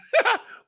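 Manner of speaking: a short, high laugh of disbelief; sudden high scoff. Real, not acted. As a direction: laugh off the idea. Laughs at the idea.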